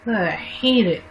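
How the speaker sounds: usual speaking voice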